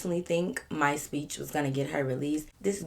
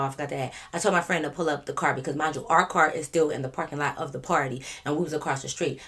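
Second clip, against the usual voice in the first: normal talking voice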